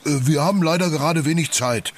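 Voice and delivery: high, quick character voice; reluctant Yoshi. A narrator's reading. Yoshi putting off the parrot, high and hurried. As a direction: excuse yourself fast in a high-pitched voice.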